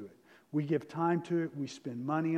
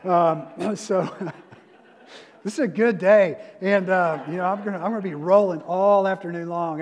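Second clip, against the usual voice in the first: too much caffeine; 3 semitones higher, 9 dB louder than usual. Real, not acted. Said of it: "I've already had six shots of espresso today".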